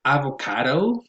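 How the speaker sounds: avocado with CA stressed; a-vo-CA-do, punching CA in avocado; stress on third syllable. Shown as a demonstration. Introducing the next word to learners, avocado stressed on CA. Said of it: "stress goes on the third syllable".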